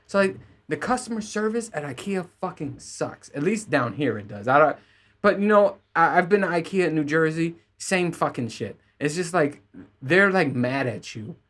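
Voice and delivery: usual speaking voice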